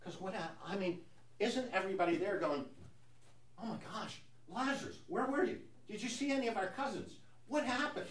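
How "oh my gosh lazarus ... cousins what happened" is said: high-pitched, gushing disbelief; mock-astonished high voice; astonished relatives. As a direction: play amazed relatives firing questions, voice way up high. Voicing Lazarus's astonished relatives.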